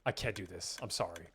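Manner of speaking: quietly refusing, apologetic; mock refusal. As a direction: let them down softly and sorrowfully. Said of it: "I'd be like".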